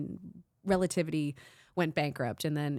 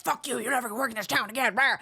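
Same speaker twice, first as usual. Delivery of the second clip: higher, fast mock-furious snarl; angry mogul; 6 semitones higher, faster than usual. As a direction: spit it out like a spurned mogul. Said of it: "he's like".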